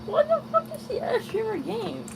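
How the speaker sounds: very high, squeaky mocking voice. Mocking the question in put-on voice.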